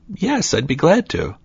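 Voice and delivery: lightly voiced polite girl; cheerful high reply; eager sweet girl. Girl answers kindly.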